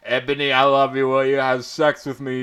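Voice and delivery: loud, lovesick declaration, love very loud; lovestruck outburst. A narrator's reading. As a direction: blurt out his love to Ebony. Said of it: "a lovey-dovey look in his eyes".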